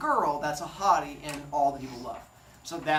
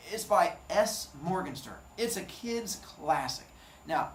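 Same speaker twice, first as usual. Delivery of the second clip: ordinary talk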